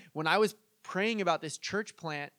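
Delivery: usual speaking voice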